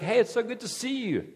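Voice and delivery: a light mock of a carefree welcome; mock casual Jesus. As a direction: play a too-easygoing buddy Jesus. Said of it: "he's just gonna be like".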